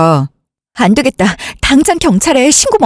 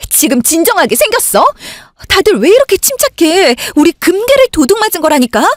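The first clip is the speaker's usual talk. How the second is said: higher, exasperated protest at everyone's calm; frantic, can't believe they're so relaxed; upset outburst; 7 semitones higher than usual. Acted. Older sister to her family, upset over the stolen gold, exasperated high protest.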